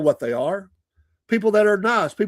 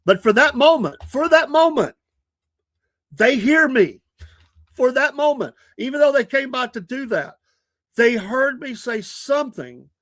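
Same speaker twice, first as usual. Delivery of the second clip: higher, louder preaching voice that slows at the end; 7 semitones higher, 3 dB louder than usual. Acted. Preaching about planting the seed.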